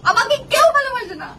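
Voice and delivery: self-pitying wail. Acted. Wife complaining nobody loves her, acted self-pitying wail.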